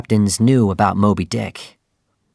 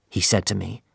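ordinary delivery

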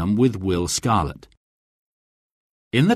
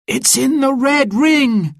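much higher, slow excited call; excited exclamation; 4 dB louder than usual. A narrator's reading. Onlooker calls out the missed shot, excited. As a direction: shout the news of the miss. Crowd reacts to the miss.

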